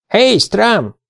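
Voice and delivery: high-pitched call to a dog; light, high calling voice. A narrator's reading. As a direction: hail the dog by name, pitch jumping up. Calling the dog over in story.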